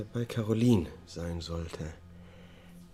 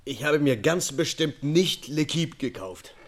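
indignant denial; 9 semitones higher, 6 dB louder than usual. Acted. Defendant protests to the judge.

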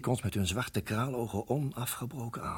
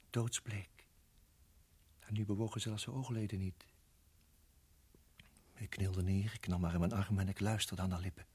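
hushed tenderness; 6 dB quieter than usual. Acted. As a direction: speak softly beside her. Vrouw bewusteloos, hij fluistert. (zacht en bezorgd)

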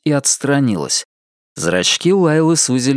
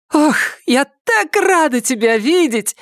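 high, bright joyful cry as Lila; overjoyed exclamation, woman's voice; exclaiming joyfully. Acted. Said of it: "воскликнула Лайла".

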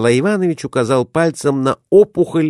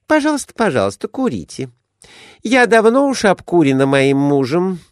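higher, quick eager lady; lady's voice; 8 semitones higher than usual. A narrator's reading. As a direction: chirp it out like a fussy wife. Glafira answers eagerly.

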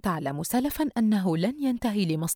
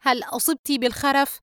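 laughing mockery; 8 semitones higher, 4 dB louder than usual. A narrator's reading. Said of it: "فريد ضاحكًا".

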